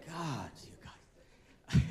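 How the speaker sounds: slow, breathy laughing whisper. Real, not acted. Laughing whisper after joke.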